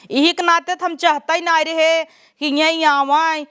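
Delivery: ordinary talk, nothing marked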